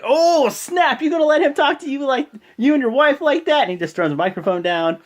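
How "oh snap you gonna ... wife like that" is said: loud, high mock outrage; egging on. John Cena imitated egging on a rival, excited. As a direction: stir the fight up, pitched way up.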